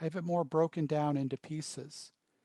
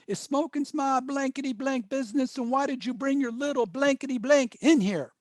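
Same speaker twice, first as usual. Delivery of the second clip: high, indignant yell, louder toward the end; retold angry scream; 8 semitones higher, 4 dB louder than usual. Smoker yells down the hallway at the departing counselor, indignant mock scream. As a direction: imitate an irate man yelling after someone. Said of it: "and he screams".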